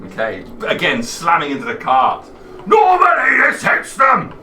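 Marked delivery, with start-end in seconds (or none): 2.7-4.3 high, loud giant cry; frustrated giant shout. Acted. Giant shouts after missing.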